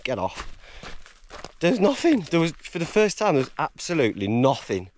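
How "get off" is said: lightly voiced inner urging, low and soft; voice in the head. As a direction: drop low and quiet, like a tempting thought. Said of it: "there's no voice going".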